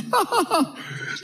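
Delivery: put-on high giddy childlike giggle; a child's delighted high giggle; giddy laugh. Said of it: "giddy children".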